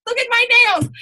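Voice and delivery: high, loud squeal of horror at her nails; mock shocked squeal. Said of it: "I was like".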